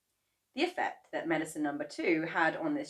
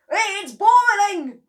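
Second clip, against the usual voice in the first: high, loud, slow exclaim; excited cry; 14 dB louder, much slower than usual. A narrator's reading. Mister Cranky at the pot, loud and high. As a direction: marvel loudly. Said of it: "cried Mister Cranky".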